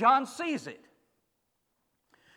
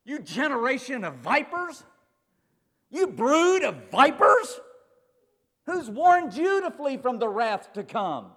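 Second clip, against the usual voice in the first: John's rebuke; 5 semitones higher, 7 dB louder than usual. Retold or imitated. John the Baptist shouting at the crowd, loud rebuke. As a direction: thunder it at them like John the Baptist. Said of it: "he just yells at 'em".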